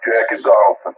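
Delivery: bassy off-frequency radio; deep muffled off-frequency signal; mistuned ham radio. Real, not acted. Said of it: "your audio is much, uh, more bass, and it sounds like you're off frequency".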